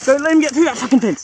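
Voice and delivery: high, loud warning to a mate; real alarmed shout; urgent shouted order. Real, not acted. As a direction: yell it before the animal escapes. Wombat escaping toward the fence.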